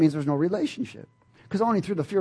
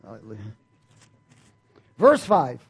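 ordinary talk, nothing marked

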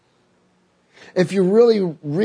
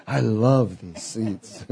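blissful laughing; 6 semitones lower, 5 dB quieter, much slower than usual. Retold or imitated. Repeated, laughing with pleasure.

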